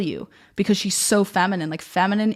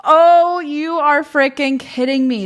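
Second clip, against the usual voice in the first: much higher and louder, slow, drawn-out amazement; gasping delight at a synchronicity; stunned disbelief; 8 semitones higher, 8 dB louder, slower than usual. Real, not acted. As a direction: let the shock burst out, then stretch it. Stunned by the page she opened.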